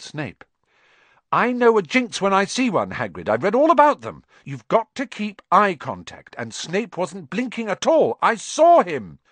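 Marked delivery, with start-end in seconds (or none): 1.3-9.2 insistent Hermione. A narrator's reading.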